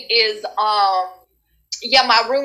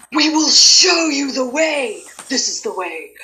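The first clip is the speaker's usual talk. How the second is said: slow, drawn-out solemn proclamation; stretched-out mystical mentor voice; gaming universe chorus. Acted. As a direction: intone it like a wise collective guide. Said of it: "the entire gaming universe is like".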